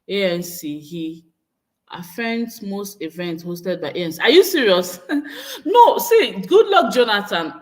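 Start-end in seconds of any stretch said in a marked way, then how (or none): 4.2-5.0 loud, high "are you serious"; surprised outburst. Real, not acted. Reacting to surprising comment. 5.0-5.6 high, sudden laugh; burst of laughter. Real, not acted. Laughing at the comment. 5.7-7.5 loud, high, through laughter; laughing while talking. Real, not acted. Still laughing at the comment.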